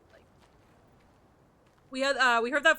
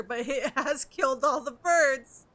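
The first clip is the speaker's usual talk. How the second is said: laughing; 2 semitones higher, 9 dB louder, slower than usual. Real, not acted. Laughing through line.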